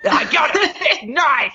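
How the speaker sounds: high-pitched, heated threat; heated comeback. Acted. Boss threatening a defiant underling, heated. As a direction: jump up high to top his boast.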